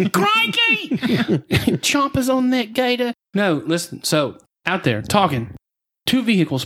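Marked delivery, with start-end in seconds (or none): 0.1-0.9 squeaky high Australian Crikey, laughing through it; a laughing Aussie croc-wrangler exclaiming Crikey!; Australian croc hunter. Pretend. Introduced as Crocodile E-Money, laughing Aussie croc hunter Crikey. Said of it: "Crocodile E-Money". 1.8-3.1 Australian croc hunter. Pretend. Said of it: "Crocodile E-Money".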